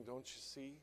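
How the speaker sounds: ordinary talk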